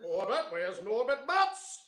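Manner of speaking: booming high call of a name; John Lithgow voice. Pretend. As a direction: call out loud like his old co-star. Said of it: "I'll do his voice".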